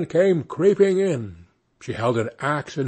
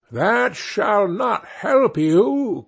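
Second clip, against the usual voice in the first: furious witch shout; 6 semitones higher, much slower than usual. A narrator's reading. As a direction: bellow it out in rage. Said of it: "cried she".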